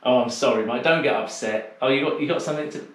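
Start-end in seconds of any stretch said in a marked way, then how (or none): none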